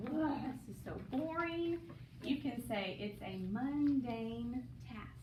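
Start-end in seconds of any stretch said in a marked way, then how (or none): 0.1-1.8 put-on bored kid. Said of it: "If you do something that's just like".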